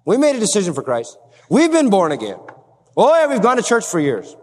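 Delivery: mock-pious insistence; self-assured churchgoer. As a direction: act out an easy claim to faith. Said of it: "who claim to be in the light".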